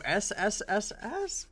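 long snake hiss; drawn-out s like a snake. Pretend. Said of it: "fucking snake boy out here".

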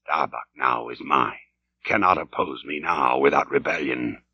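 low, hushed gloating to himself; murmured captain's aside; private aside. Acted. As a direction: drop far down, soft and secretive.

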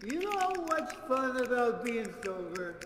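voice of Mister Beer. Pretend. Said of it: "I'm gonna throw my voice into Mister Beer".

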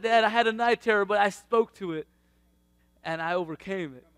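put-on the boy announcing his victory, loud; proud son's report. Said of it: "He's like".

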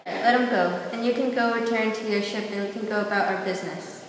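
loud defiant demand; defiant shout. Acted. Friend defying the aliens to free Jimmy, loud shout. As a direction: shout them down to free him.

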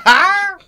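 loud, high-pitched laugh; helpless real laughing outburst; burst of laughter. Real, not acted. Intro spoiled by laughter.

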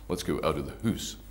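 American-Scottish lilt, "hoose" for house; Canadian-Scottish accent. Shown as a demonstration. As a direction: imitate a Vancouver accent with Scottish vowels. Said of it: "kind of American Scottish".

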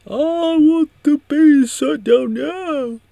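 very high, loud, drawn-out shriek; mock screamed order. Villain orders the base shut down, hammed-up screaming. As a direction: shriek it slowly like an over-the-top villain.

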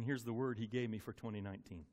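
ordinary talk, nothing marked

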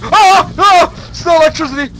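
high sudden startled yells; startled shout. Real, not acted. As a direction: jump with fright and shout. Sudden scare in game.

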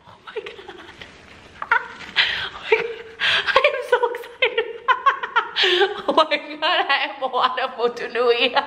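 high squealing through giggles; excited laughing. Real, not acted. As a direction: laugh out the words, giddy with joy. Thrilled unboxing a Moana doll.

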